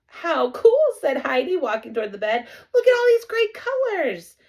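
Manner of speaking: put-on high, loud delighted girl; thrilled girl. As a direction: gush over the colors. Heidi thrilled by colors.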